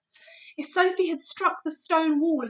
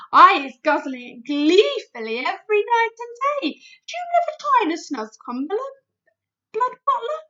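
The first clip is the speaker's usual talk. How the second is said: higher, louder, eagerly tempting; BFG, coaxing; 8 semitones higher, 10 dB louder than usual. A narrator's reading. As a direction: tempt the bully giant cheerfully.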